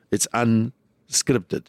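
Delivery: drawn out slow, leaning on unscripted; deadpan slow. Shown as a demonstration. Comedian answering the journalist with a slow deadpan "unscripted". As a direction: let "unscripted" hang, very slow, as if it explains everything. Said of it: "I said to her".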